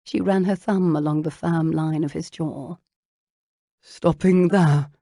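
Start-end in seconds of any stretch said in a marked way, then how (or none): 3.8-4.9 tight, strained and slow. A narrator's reading. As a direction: hold back, voice strained. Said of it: "His voice sounded strained".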